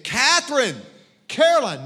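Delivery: loud call across a pool. Retold or imitated. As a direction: call their names loudly. Calling daughters at pool.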